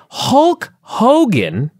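HULK HOGAN drawn right out and pitched high; slow, high, hammered stress on each word of Hulk Hogan; emphatic repetition. Real, not acted. Incredulous emphatic repeat of the name.

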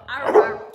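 loud playful "arr, arr" bark; loud dog-noise cue; imitated bark. Real, not acted. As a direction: bark to get the dog talking.

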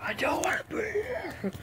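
high, breathy demonic whisper. Retold or imitated. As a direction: hiss it like a possessed thing. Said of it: "the most, like, demonic voice".

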